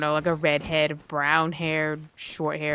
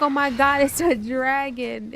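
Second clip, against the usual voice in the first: mock astonishment; 6 semitones higher than usual. Onlookers realise it's a dragon, mock shock. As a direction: imitate shocked onlookers, stretching the last words. Retold crowd discovers a dragon.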